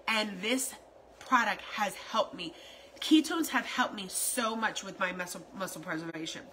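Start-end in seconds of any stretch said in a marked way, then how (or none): none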